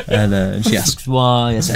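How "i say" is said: ordinary talk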